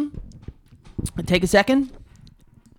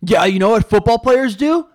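loud cocky football jock; jock bragging; 6 semitones higher, 8 dB louder than usual. Retold or imitated. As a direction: brag like a meathead jock. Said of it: "all the kids on the football team would be like".

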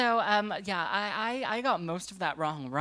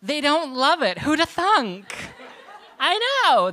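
laughing; 8 semitones higher, 8 dB louder than usual. Real, not acted. Laughing punchline about Welsh radio.

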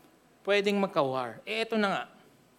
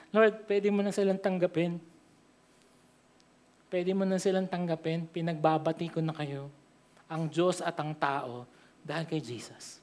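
soft, lower, fading gentle plea; tender plea; 4 semitones lower, 7 dB quieter than usual. Retold or imitated. Jesus asking God to accept the people, soft tender plea. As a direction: plead softly on their behalf.

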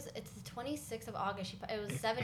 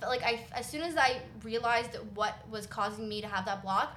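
ordinary talk

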